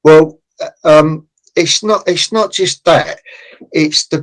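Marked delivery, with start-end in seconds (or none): none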